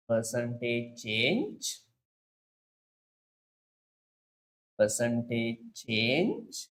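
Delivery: slow, stretched Percentage change with change rising; slow deliberate emphasis on change; stress on change. Shown as a demonstration. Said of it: "I emphasize this word".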